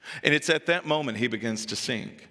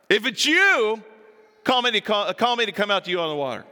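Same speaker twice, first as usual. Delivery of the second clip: Peter daring him, higher and louder, then rushing; bold Peter; 6 semitones higher, 4 dB louder than usual. Acted. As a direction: play the brash fisherman calling out across the water. Peter challenging Jesus, voiced.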